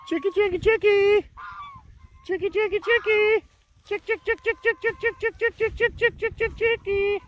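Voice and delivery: very high sing-song chick call; calling chickens. Real, not acted. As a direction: chirp it out to the hens.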